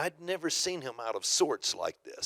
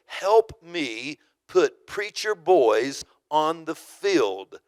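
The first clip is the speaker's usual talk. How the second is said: slow, louder, every word of the slogan weighed; proclaimed slogan; 3 semitones higher, 5 dB louder, much slower than usual. Retold or imitated. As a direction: announce it like a banner slogan. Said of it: "his phrase was written out so plainly".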